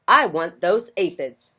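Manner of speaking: loud demanding grump; grouchy ladybug. Acted. Grouchy ladybug claiming the aphids, loud and cranky.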